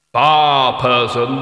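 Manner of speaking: loud, slow, booming order; heavy leaden voice. A narrator's reading. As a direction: boom it out like lead striking rock. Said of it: "hitting a rock with a roll of sheet lead".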